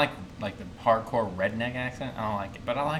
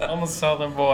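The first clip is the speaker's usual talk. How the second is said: laughing; 5 semitones higher, 6 dB louder than usual. Real, not acted. Admits bias while laughing.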